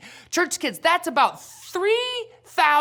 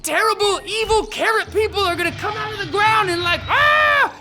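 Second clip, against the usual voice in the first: mock panic; 8 semitones higher than usual. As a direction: play up the panic like a scared kid. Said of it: "I started to get scared. And I was like".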